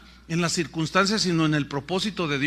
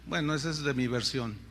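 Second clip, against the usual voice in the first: usual speaking voice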